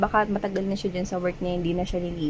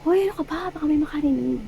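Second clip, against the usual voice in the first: higher, teasing shush; mock flustered scolding; 8 semitones higher, much faster than usual. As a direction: scold playfully as if someone might overhear. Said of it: "Yung parang ganun".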